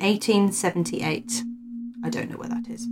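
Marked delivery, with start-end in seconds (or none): 2.0-2.9 very soft, whispered aside; murmured admission under the breath; quiet aside. Real, not acted. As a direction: drop to a whisper, off the script. Muttered aside during the reading.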